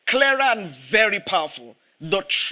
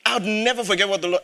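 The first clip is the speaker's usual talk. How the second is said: ordinary delivery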